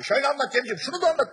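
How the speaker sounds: loud, high, pushy coaching of Cemciğim; pushy heckler. Retold or imitated. Heckler loudly bosses the comedian on stage, high and pushy. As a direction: crowd heckler loudly telling the comedian how to tell it. Heckler instructing, comic replying.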